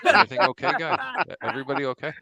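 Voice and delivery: a lightly voiced Leo Haggerty checking on the guys; Leo Haggerty impression. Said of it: "You guys wanna see Leo Haggerty?".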